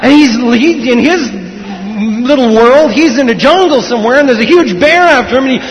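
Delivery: high, loud breathless-chase narration; excited storytelling. Real, not acted. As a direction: tell the chase with mounting urgency.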